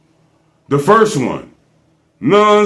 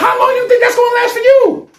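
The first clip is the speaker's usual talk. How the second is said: very high, rushed squeal; mocking disbelief; faster than usual. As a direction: throw the question back in a fast falsetto. Mocking echo of the co-host's question.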